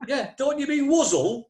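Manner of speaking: a loud, laughing attempt at a Midlands accent. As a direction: mimic the Midlands local with a chuckle. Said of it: "I can't do a Midlands accent".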